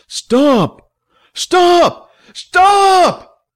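escalating high, loud yells; yelling. A narrator's reading. Billy silences the screaming crowd, yelling. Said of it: "Billy yelled".

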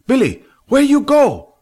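very high, loud call after him; Fat calling. A narrator's reading. Fat calling after limping Billy, very high loud voice. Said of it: "Fat asked".

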